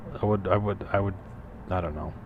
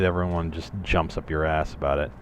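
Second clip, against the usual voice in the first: ordinary talk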